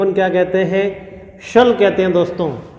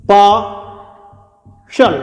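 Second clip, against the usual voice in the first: stretched out very slowly; much slower than usual. Shown as a demonstration. Teacher stretching partial so students catch each sound. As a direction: draw partial out long and slow. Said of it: "देखिए कैसे होगा".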